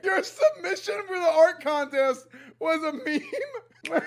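high-pitched laughing, can't believe it; laughing disbelief. Real, not acted. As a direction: wheeze through the laughter.

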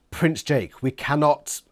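ordinary delivery

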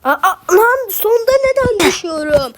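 high, loud exasperated outburst; frustrated yell. Real, not acted. Gamer falling at the end of the course, yelling in frustration. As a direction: cry out, nerves shot after falling again. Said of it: "Abi sinirlerim bozuldu".

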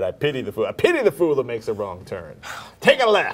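a hint of Mister T's growl; rising to a loud bark; Mister T impression. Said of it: "[name] said".

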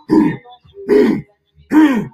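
short, forceful lion hums; imitated lion rumble. Speaker imitating a wild lion, forceful rumbling hums. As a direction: hum like a lion, in separate bursts. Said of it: "listen to that vibrational tone".

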